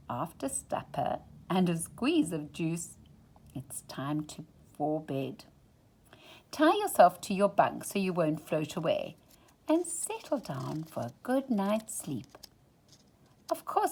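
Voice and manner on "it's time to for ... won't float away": usual speaking voice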